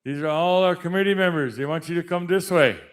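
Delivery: announcer introducing names; powwow emcee. Acted. Introducing committee members.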